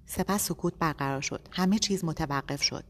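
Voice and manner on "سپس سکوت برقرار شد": plain reading voice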